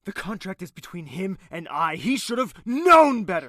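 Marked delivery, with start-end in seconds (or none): 2.0-3.5 much louder, higher and hard; raised angry voice; loud anger. Acted. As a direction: throw it at her. Villain defends himself, heated.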